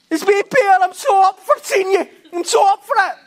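hammed-up excited high shout; loud, high, over-eager calling; Peter. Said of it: "he's getting all excited".

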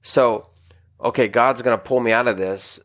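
usual speaking voice

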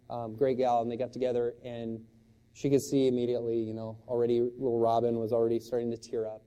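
ordinary talk

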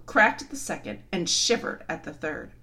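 usual speaking voice

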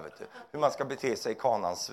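usual speaking voice

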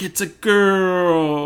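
imitating a bold banner, high and loud; big bold announcement. Reading out the emailed gender result, big and bold. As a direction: draw it out slowly, hugely loud. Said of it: "like, big bold".

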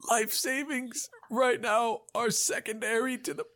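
high, squeezed character voice; strained victim. Acted. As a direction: argue while being strangled. Choked man argues with his attacker.

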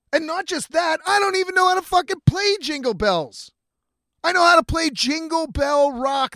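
a mock outraged rant at the director; exasperated rant. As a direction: argue back in disbelief, voice climbing. Ranting at director, rising pitch.

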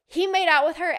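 loud gossip. Retold or imitated. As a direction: blurt the gossip to the whole bus. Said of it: "she talks very loud".

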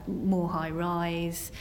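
ordinary delivery

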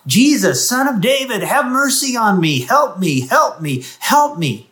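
high, loud cries for mercy; desperate shout. Acted. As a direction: call out over the crowd, begging. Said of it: "he begins to shout".

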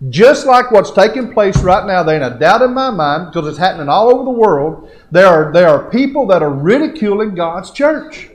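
loud, high-pitched preacher's delivery; fired-up preaching. Real, not acted. Preacher warning that people ridicule God's church, fired-up preaching. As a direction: preach it with rising force. Preacher rises to full force.

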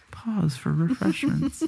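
slow, high mock announcement; mock announcer. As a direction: announce the break slowly. Mock announcement during drinking.

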